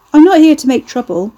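high and loud, for everyone to hear; loud announcement. A narrator's reading. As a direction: announce it to the whole room. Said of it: "Ricky announced to the world at large".

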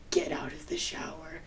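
reliving a tearful whispered get out; whispered order. Retold or imitated. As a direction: whisper it tight and urgent. Telling boyfriend to leave, whispered.

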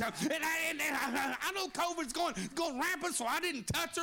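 rising, shouted preaching cadence. Acted. Preacher stirring up his congregation, rising shout. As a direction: preach it out with building fire. Preacher builds to full force.

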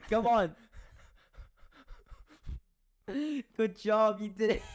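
high, giggling cheers; laughing encouragement. Real, not acted. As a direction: cheer him on through laughter. Egging on, laughing.

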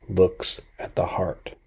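slow, soft whisper. Real, not acted. Preacher tells listeners Jesus sees the heart, whispered. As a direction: let it fall to a slow hush. Preacher stresses his key point.

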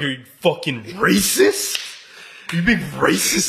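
slow, drawn-out incredulous challenge; mock accusation. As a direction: stretch it out in mock outrage. Acted confrontation over racist remark.

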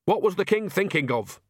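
high-pitched, blustering complaint; acted cranky outburst; indignant outburst. Nanny loudly protests, told off for shouting.

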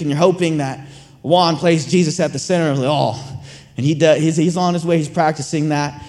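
usual speaking voice

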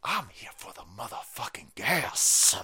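whispered aside, hushed. Pretend. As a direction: lean in and whisper it like a secret. Mock confession aside.

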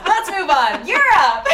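loud, high burst of excitement; excited exclamation. Real, not acted. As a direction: launch the new topic with a big, high shout. Abrupt exclaimed change of topic.

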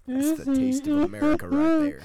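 loud high hyped ad announcer. Acted. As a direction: belt it like a TV ad. Mock commercial after can pop.

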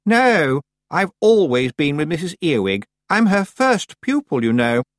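high, boastful, self-important voice; proud. A narrator's reading. Anagramma boasting she is first pupil, proud. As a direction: boast of it loftily. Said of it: "Anagramma added proudly".

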